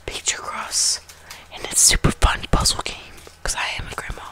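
murmured phone tour; whispering. Real, not acted.

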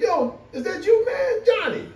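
a coworker can't believe who's talking. Retold or imitated. Surprised coworker at work, high voice.

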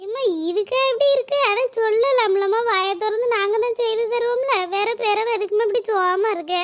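put-on a kid asking mom why she cried; children asking their sad mother what happened; worried child.

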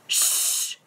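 sharp hissing shush; hissed shush. Acted. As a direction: hiss them quiet. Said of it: "hissed Professor McGonagall".

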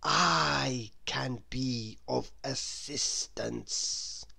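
slow, soft, wheezy and oily; crocodile's oily slow wheeze. A narrator's reading. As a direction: ooze the offer out slyly. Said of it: "wheezed a particularly oily voice".

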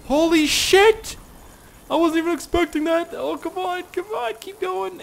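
very high, loud excited cheering; cheering someone on. Real, not acted. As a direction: urge the jump on, thrilled. Huge jump, urging it on.

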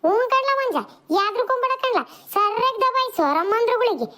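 put-on squabbling kids. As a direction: play kids trading insults and pulling hair. Kids boast and egg on.

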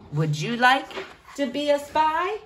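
plain reading voice

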